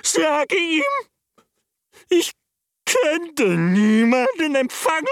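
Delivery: a changed voice moaning from behind a door; plaintive voice. A narrator's reading. The hidden master sends the visitor away, high and plaintive. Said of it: "antwortete eine klagende Stimme".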